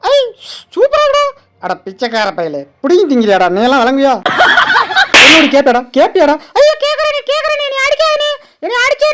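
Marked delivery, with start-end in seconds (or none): 1.6-6.4 deep gruff animal voice. Acted. Voiced animal scolding another.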